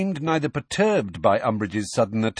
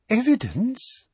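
Umbridge voice; 13 semitones higher, slower than usual. A narrator's reading.

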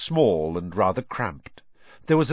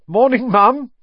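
high, loud mock-innocent cheer; jaunty, winning voice; 14 semitones higher, 10 dB louder than usual. George greets his furious mum with a jaunty, high, loud hello. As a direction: brightly charm his way out of trouble. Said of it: "in what he clearly thought was a jaunty, winning voice".

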